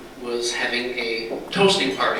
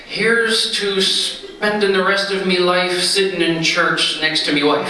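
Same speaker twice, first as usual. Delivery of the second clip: slow, higher, innocent-sounding Irish toast; sheepish husband covering; 6 semitones higher than usual. Acted. Husband to wife, hiding the real toast: slow, higher innocent voice. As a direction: make it sound pious and harmless, drawn out.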